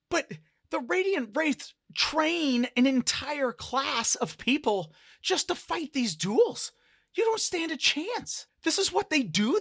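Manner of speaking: loud, high-pitched tirade; frantic protest. Acted. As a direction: pour out the warning at full alarm. Dancer continues her alarmed outburst.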